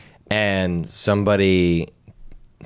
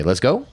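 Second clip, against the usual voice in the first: "Let's go" with a raised, rising "go"; imitated questioning rise on "go"; statement as question; 5 semitones higher than usual. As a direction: say "Let's go" as if asking. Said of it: "you say it with a question phrase".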